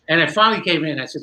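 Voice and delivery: ordinary delivery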